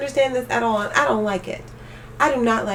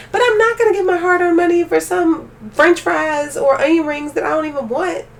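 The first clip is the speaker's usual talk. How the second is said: higher and louder, fed up; heated rant; 6 semitones higher, 4 dB louder than usual. Real, not acted. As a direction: push it up in outrage.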